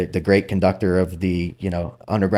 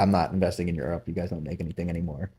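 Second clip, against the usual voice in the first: low-key imitation, quick and much softer; matter-of-fact; 3 semitones lower, 9 dB quieter, faster than usual. Investor bluntly turning down Europe in class, retold matter-of-fact. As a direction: toss off the retold verdict softly and quickly, dropping away. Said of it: "very matter of fact".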